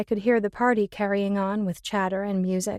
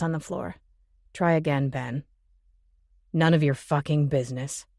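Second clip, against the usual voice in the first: mocking male voice; 6 semitones lower than usual. Jimmy taunting Ben.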